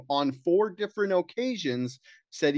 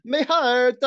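high, loud overacted cry of pain; theatrical mock agony; mock heart attack; 11 semitones higher, 8 dB louder than usual. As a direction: fake a heart attack, hammed up. Echoing the faked heart attack.

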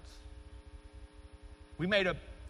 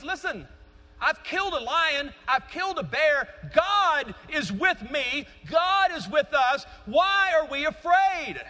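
higher and louder, slowing down on God is with me; bold declaration; 8 semitones higher, 5 dB louder, slower than usual. Retold or imitated. David telling Saul of his victories, fervent and bold. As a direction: proclaim it with rising fervour.

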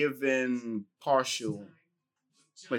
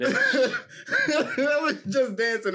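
laughing; 9 semitones higher, much slower than usual. Real, not acted. Laughing while explaining.

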